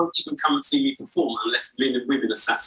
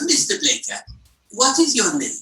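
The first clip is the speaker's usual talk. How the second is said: higher-pitched questioning rabbi; rabbi character; 6 semitones higher than usual. Acted. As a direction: quiz him as the Yiddish-accented rabbi. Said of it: "And he goes".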